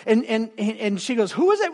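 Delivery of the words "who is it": much higher, quick curious question; curious wife. Retold or imitated. Wife asking her husband who called, high curious voice. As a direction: ask it like a wife peeking over.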